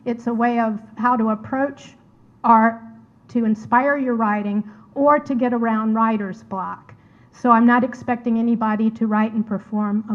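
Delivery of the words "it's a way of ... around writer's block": ordinary talk, nothing marked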